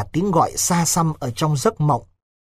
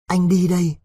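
pained, emotional; 2 semitones higher than usual. A narrator's reading. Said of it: "giọng rất cảm động, đau đớn".

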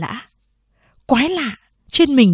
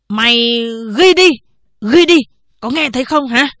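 yelling order; 3 semitones higher, 5 dB louder than usual. Acted. Headman shouting at the clerk to write it down. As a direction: bark the order at him.

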